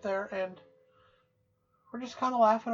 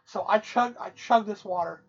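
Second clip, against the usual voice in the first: ordinary talk, nothing marked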